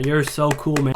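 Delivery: usual speaking voice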